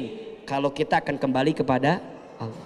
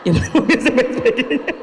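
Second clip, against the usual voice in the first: louder, faster repeat through laughter; laughing; 7 semitones higher, 5 dB louder, faster than usual. Real, not acted. Preacher repeating the joke to the congregation, laughing. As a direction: laugh it out as a punchline.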